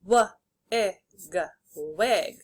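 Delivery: "W, ig" as separate sounds, then "wig" whole; sounded out, blended. Shown as a demonstration. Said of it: "say the sounds and then blend".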